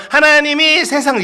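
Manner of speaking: put-on high, childlike reciting; younger boy's recitation. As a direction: recite loudly and high, like a boy before puberty. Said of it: "일월 달만 해도".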